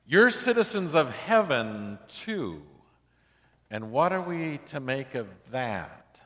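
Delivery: ordinary delivery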